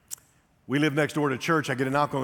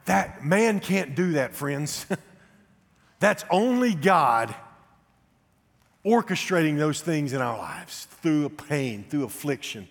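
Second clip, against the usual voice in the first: louder, higher, slow proclamation; rising preaching; 5 semitones higher, 4 dB louder, slower than usual. Real, not acted. Pastor proclaiming God's hand in his pain, at full force. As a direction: preach it out to the congregation.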